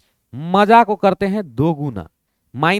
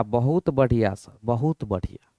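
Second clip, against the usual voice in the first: softer student praise; 6 semitones lower, 7 dB quieter than usual. Pretend. Teacher voices pleased student.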